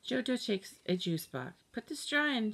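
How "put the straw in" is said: put-on high demanding little kid; little girl voice. A narrator's reading. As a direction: pitch it up like a small child. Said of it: "she says".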